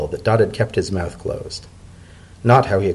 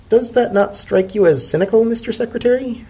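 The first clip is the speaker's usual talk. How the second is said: a hint of a cool, higher-pitched foreign dignitary; lightly voiced ambassador Macaulay; 12 semitones higher than usual. A narrator's reading. Ambassador questioning the secretary about the holiday, lightly voiced higher.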